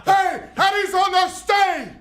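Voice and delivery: put-on high, drawn-out Cuban-accented shout; roll-call hollered in a mock Cuban accent; Yoel Romero. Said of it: "in this heavy accent".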